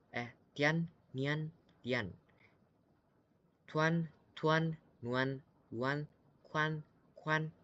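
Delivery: clear, separate pinyin syllables; pinyin drill. Shown as a demonstration. Teacher drilling students: pian, tian, tuan. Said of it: "kita coba pelan-pelan ya".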